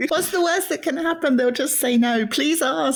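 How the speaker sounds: high, laughing plea; playful pleading. Real, not acted. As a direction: coax them, half laughing. Host playfully pleading, still amused.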